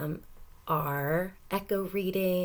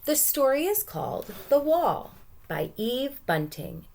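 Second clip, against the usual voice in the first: ordinary talk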